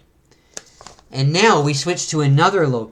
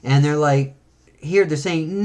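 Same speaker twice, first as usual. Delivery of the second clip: ordinary delivery, nothing marked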